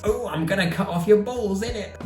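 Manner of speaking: hammed-up gross cockney bad guy; cockney movie villain. Vlogger parodying Hollywood bad guys, cockney accent. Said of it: "bad guys are always cockney and gross".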